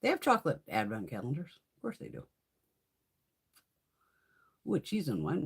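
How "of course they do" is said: a soft, dry whispered aside. Real, not acted. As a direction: murmur it to yourself.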